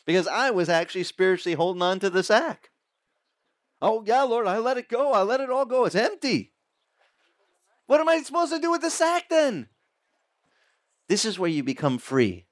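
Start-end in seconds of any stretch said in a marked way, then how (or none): 3.8-6.5 high, bright, overeager insistence; eager self-assured claim. Pretend. The preacher assuring God the sack is empty, overeager. 7.9-9.7 much higher, puzzled innocent voice; clueless naive question. Pretend. The preacher asking God, genuinely baffled, about the empty sack.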